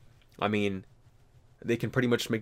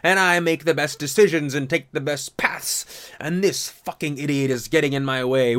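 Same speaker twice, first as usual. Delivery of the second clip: vindictive road rage; 4 semitones higher, 5 dB louder than usual. Retold or imitated. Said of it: "that vindictive road rage of like".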